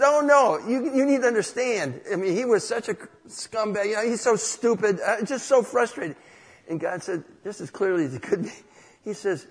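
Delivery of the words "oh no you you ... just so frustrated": loud, high-pitched retold venting; exasperated protest. Man pleads his case to God about Joe, exasperated protest. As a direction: argue back heatedly, raising pitch and volume.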